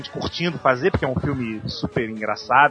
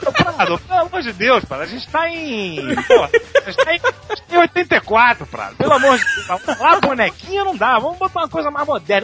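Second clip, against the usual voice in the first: exasperated director; 11 semitones higher than usual. Retold or imitated. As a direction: beg him to stop with comic despair. Director pleading with Prado.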